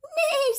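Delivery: very high, loud whinny; shrieky storybook whinny; horse neigh. A narrator's reading. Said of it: "said the horse".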